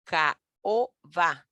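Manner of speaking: "ca o, va" in three separate beats; separated vowels. Shown as a demonstration. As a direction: pause between each syllable of "ca o, va". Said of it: "separada de vocal abierta".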